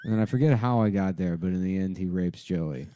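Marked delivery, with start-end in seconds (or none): none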